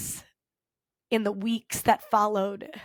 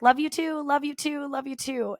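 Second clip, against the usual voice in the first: high mock kids' call, fading; retold yelling back; 5 semitones higher than usual. Kids answer their teacher on the way out, mock yell. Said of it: "some kids would yell back".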